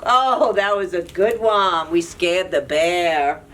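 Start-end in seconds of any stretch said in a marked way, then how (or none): none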